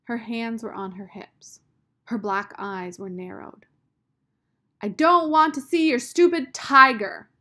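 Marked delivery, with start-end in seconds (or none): 4.8-7.3 high, loud furious shout; angry shout. A narrator's reading. Sistine shouts at Rob in a fury. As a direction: yell it at him in a fury. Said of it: "she shouted".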